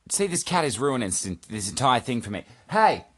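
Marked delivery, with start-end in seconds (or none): 2.7-3.0 sharp high yell; shout at cat. Real, not acted. Host yelling at his cat mid-recording, loud high shout. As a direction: snap at the cat to get off.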